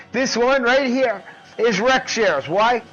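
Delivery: high, loud showman yelling, Boom at the peak; loud hype shout. Acted. Revealing Rex Shares to viewers with a loud, high hype shout. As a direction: whip up the crowd as you reveal each fighter.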